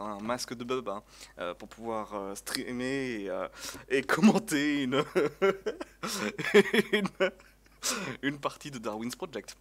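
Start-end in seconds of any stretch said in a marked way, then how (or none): 3.9-5.0 louder, words breaking into laughter; laughing. Real, not acted. Streamer jokes about wearing a baba mask, laughing. Laughs at his own joke. 5.1-6.9 high, loud burst of laughter. Real, not acted. Streamer cracks up at his mask joke, loud laugh.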